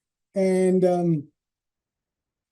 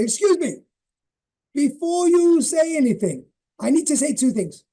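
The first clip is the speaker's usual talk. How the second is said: imitating mum; 9 semitones higher, 3 dB louder than usual. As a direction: put on mum laying down her terms. Mum voiced confronting dad at dinner.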